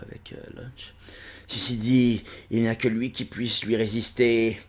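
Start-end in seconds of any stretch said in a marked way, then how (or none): 0.1-0.9 breathy whispered name. Acted. Pensée secrète du personnage. (nom chuchoté)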